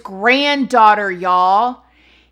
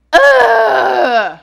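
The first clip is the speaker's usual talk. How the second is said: loud, high cry of disgust; a pained ugh, thrown out loud; disgusted groan; 10 semitones higher, 10 dB louder than usual. Real, not acted. Host disgusted by the killer's crimes, loud groan.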